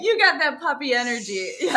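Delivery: high, laughing teasing. Real, not acted. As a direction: tease her through laughter. Cohost laughing at host.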